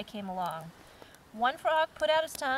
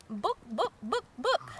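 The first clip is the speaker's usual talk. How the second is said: irritated squawk; slower than usual. Acted. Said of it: "squawks in a rather irritated fashion".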